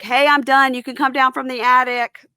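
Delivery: high, loud call up to the attic; husband calling up the stairs; retold yell up the stairs. Husband calls up to his wife in the attic, loud. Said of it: "he yells up".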